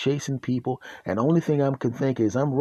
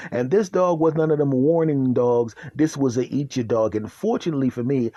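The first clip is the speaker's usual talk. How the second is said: ordinary delivery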